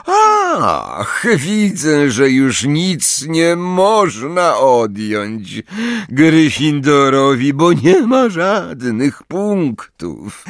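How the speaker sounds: slow, high, sneering professor. A narrator's reading. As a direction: savour every cruel word. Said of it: "uśmiechnął się szyderczo".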